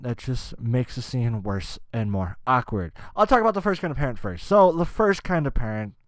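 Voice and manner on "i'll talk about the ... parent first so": ordinary talk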